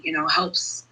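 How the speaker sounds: ordinary talk, nothing marked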